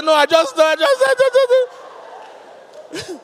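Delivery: high, loud mock blubbering; a weeping fan stumbling over words; hammed-up sobbing. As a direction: stammer through fake sobs. Mock sobbing man stammers.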